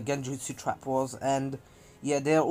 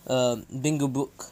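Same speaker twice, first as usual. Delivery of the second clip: plain reading voice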